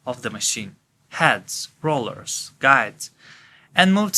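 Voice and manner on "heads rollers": ordinary delivery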